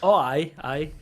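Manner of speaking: normal talking voice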